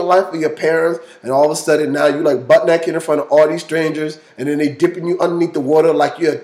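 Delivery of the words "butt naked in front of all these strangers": usual speaking voice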